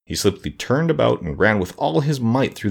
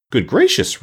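surprised exclamation; 6 semitones higher, 4 dB louder, slower than usual. Acted. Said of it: "the surprised guardian of the gates".

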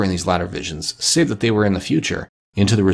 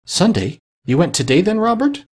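higher, rising wife's question; surprised questioning; 9 semitones higher than usual. A narrator's reading. Wife questioning her husband about his outing, surprised.

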